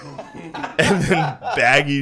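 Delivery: slow, laughing. Real, not acted. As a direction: stretch it out while laughing. Laughing describing outfit.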